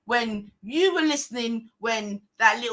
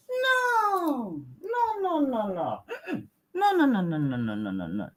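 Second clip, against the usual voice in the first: mock refusal; 4 dB quieter than usual.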